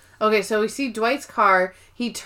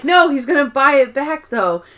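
insistent; 4 semitones higher, 4 dB louder than usual. Retold or imitated. Andy insisting.

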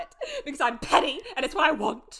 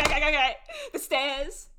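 normal talking voice

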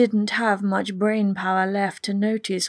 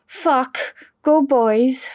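loud, high Welsh cheer; Welsh accent; 5 semitones higher, 6 dB louder, much slower than usual. Acted. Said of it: "Bronwyn's Welsh accent".

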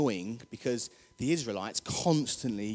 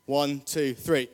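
usual speaking voice

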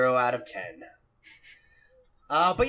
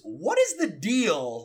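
a slow, lightly voiced stand-up set-up line; Jerry Seinfeld bit; 6 semitones higher, much slower than usual. Host introducing the topic, light Seinfeld impression, slow. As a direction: lean on it like a sitcom comedian, drawn out. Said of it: "you're messing up my Jerry Seinfeld".

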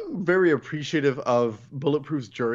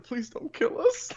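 high-pitched mock begging; mock pleading; 11 semitones higher, 3 dB quieter than usual. Hostages begging with guns to their heads, high mock plea. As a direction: plead for your life, played for laughs.

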